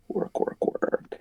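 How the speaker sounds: hushed, repeated plea to the dice; whispered urging. Real, not acted. Player willing the dice roll to work, whispering. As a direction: whisper it like a lucky charm.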